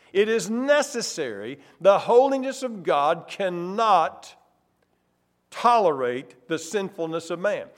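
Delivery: normal talking voice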